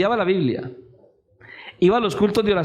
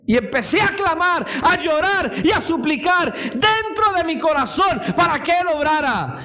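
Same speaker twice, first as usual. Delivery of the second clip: high, fervent preacher's cry; urgent pulpit delivery, pitched way up; impassioned preaching; 9 semitones higher than usual. Acted. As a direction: keep the testimony at full pitch. Preaches at full force.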